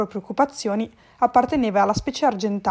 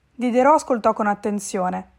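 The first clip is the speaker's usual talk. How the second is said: ordinary delivery, nothing marked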